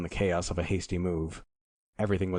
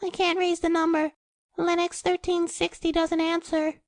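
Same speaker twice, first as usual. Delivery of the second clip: drawling nasal operator; 4 dB louder than usual. Acted. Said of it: "a drawling, nasal voice said".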